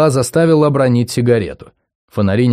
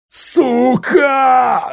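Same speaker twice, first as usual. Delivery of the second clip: pained shout; 14 semitones higher than usual. Acted. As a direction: yell from a broken nose. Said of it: "застонал урод".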